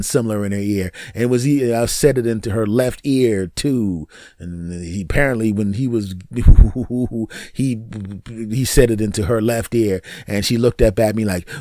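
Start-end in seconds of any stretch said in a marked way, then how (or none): 6.2-7.5 loud, rapid run of hoo-hoo-hoo; mock lovemaking hoots. Comedian imitating being in the middle of lovemaking, loud hooting. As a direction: pant out comic hoots in a rapid burst.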